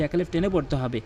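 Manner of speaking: ordinary talk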